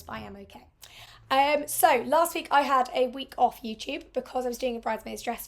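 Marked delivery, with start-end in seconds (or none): 0.0-0.7 hoarse voice fading to a whisper; croaky, almost whispered reassurance. Real, not acted. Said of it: "So I do sound a bit hoarse".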